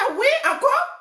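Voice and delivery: high, squeaky mock disbelief on encore; surprised retort. Retelling her reaction to the friend, high astonished outburst. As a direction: act out her startled, exasperated reply. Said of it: "J'ai dit".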